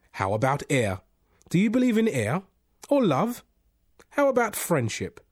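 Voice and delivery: insistent. A narrator's reading. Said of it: "The voice went on and on, making its point".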